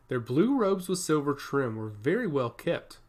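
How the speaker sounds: ordinary delivery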